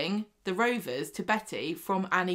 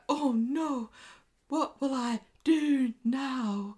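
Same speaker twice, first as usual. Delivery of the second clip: high, slow, put-on northern English voice; a landlady fretting in a hammed-up northern accent; 7 semitones higher, much slower than usual. Podcaster imitating Stella fretting over the pub, in a high, slow northern accent. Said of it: "In her best northern accent".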